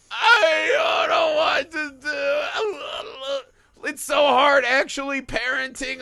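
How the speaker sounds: high, drawn-out fake blubbering; mock weeping. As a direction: ham up a crying dad, very slow and high. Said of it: "He just starts weeping".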